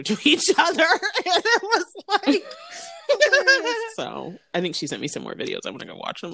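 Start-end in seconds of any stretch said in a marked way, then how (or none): 2.5-3.9 shrieking burst of laughter; loud high laugh. Real, not acted. Laughing at the filter.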